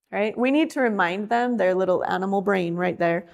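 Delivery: ordinary talk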